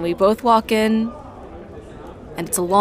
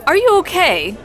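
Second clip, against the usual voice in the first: worried raised voice; alarmed; 9 semitones higher, 5 dB louder than usual. Acted. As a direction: ask in sudden alarm. Alarm at the choking.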